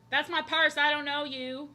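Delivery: loud, high mock-indignant yell; quoted meme line. Streamer finally recalling the meme line, mock indignant yell. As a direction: bark the meme line like a startled stranger. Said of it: "What's the line?".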